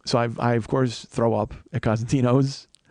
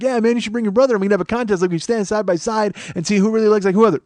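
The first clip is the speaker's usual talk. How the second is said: high, quick chatty clerk impression; imitated eager talker, pitched up; talkative clerk; 8 semitones higher, 4 dB louder than usual. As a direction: rattle it off like an overfriendly chatterbox. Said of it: "he was so talkative".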